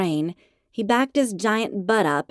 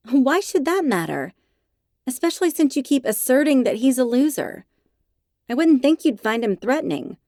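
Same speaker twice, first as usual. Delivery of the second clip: high, loud retort; indignant; 6 semitones higher, 4 dB louder than usual. Acted. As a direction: fire back indignantly. Arguing back, head sent back.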